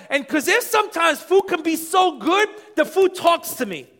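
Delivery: loud, high, fervent preaching; preaching at full force. Acted. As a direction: drive it home to the congregation. Preaching about food and flavor.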